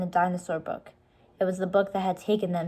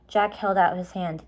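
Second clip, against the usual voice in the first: ordinary delivery, nothing marked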